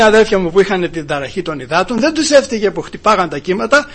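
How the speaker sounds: normal talking voice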